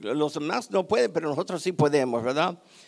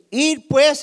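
loud prophet's answer; 7 semitones higher, 9 dB louder than usual. Acted. Voicing Eliseo's answer.

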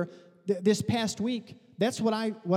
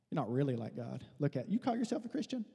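softly voiced needling devil; devil's accusation; 5 semitones lower, 8 dB quieter than usual. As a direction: accuse them quietly, almost under your breath. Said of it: "So the devil comes and says".

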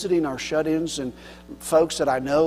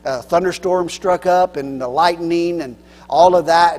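normal talking voice

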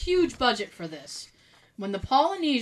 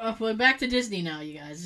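ordinary talk, nothing marked

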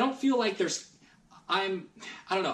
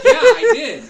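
laughing; 17 dB louder than usual. Retold or imitated. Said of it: "And I'm like".

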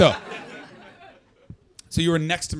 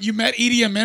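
astonished question; 6 semitones higher, 6 dB louder than usual. Retold or imitated. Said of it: "And I went".

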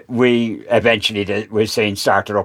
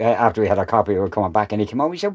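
ordinary talk

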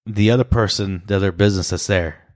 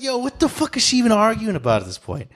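higher, baffled; mock bewildered thought; 10 semitones higher than usual. Friend retells his own baffled reaction, higher. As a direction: wonder what she's even arguing about. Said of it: "I'm like".

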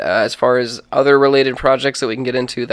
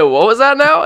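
very high, louder baffled query; puzzled asking back; 12 semitones higher, 6 dB louder than usual. Acted. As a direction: squeak the question up high, acting baffled. Acted staff asking back once more.

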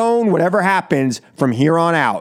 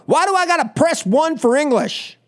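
higher, whining mock complaint; whiny complainer; 9 semitones higher than usual. Host mocking a caller who gripes about pressing one, whiny. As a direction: mimic a petulant caller griping. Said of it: "I argue with people about".

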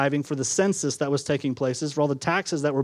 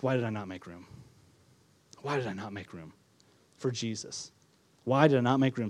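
regretful whisper; 6 semitones lower, 7 dB quieter than usual. Acted. People regretting before God's judgment.

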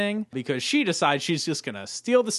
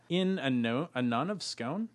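ordinary talk, nothing marked

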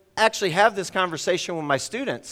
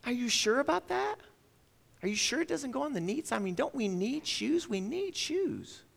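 much higher and softer, a gently pressing mother; doubtful mom; 8 semitones higher, 6 dB quieter than usual. Retold or imitated. Mother coaxing her son to reconsider, high and soft. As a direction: nudge him softly toward the answer.

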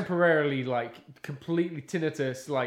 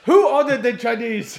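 mock soldier quip; 8 semitones higher, 9 dB louder than usual. Joke quote of soldiers.

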